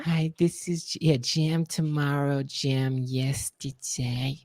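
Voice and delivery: very slow, soft, drawn-out greeting. Retold or imitated. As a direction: drag every word softly like a dreamy star. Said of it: "and he goes".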